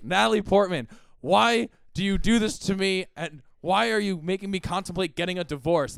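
comic aggrieved complaint; exasperated husband. Acted. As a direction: confront your wife in hammed-up frustration. Acted husband pleading with fridge wife.

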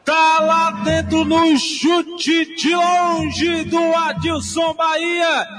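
ordinary delivery

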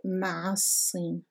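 مع الصين run together without a pause; silent hamza. Shown as a demonstration. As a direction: glide from مع straight into the s of الصين. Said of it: "shows that it's not pronounced". (مع الصين linked, with no al sounded)

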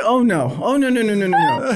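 high, loud mock protest; emphatic objection. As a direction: rattle off mock-indignant noes. Acting wife's emphatic refusal.

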